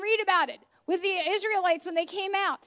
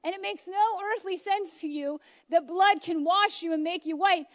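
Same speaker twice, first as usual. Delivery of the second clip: usual speaking voice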